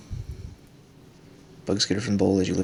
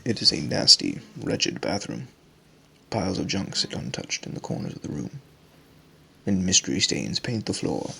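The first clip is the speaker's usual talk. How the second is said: game master narration; 3 dB quieter than usual. A narrator's reading. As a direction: describe the grim room to the players. Game master describes the scene.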